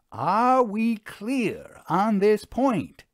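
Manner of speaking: high, loud and slow, each word pressed; pointedly. A narrator's reading. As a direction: repeat it so no one can miss it. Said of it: "Vera reiterated pointedly".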